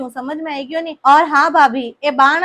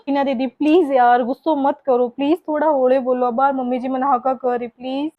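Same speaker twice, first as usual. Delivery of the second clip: ordinary talk, nothing marked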